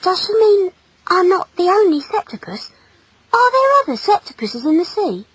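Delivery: put-on high, wondering boy's voice; puzzled thought. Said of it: "thought Conrad".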